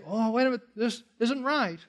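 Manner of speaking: lightly voiced skeptic protesting; a hint of a doubter pushing back; imagined objector. Preacher voicing a doubter in the congregation, mild protest. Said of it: "somebody could say".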